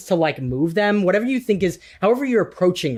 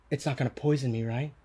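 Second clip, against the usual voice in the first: wary NPC question; 6 semitones lower, 9 dB quieter, faster than usual. Acted. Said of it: "um, and goes".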